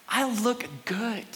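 slow, drawn-out contentment; acted serene, unhurried reply; happy unbothered guy. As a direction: answer panic with placid self-assurance. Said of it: "He's happily standing there".

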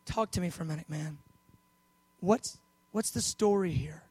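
soft, low, unhurried question; cool and calm. Acted. Jesus talking to the father amid a manifestation, cool and calm. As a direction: ask gently, keeping your cool. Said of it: "Jesus is cool".

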